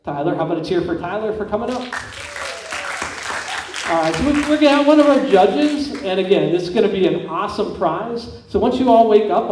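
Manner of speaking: normal talking voice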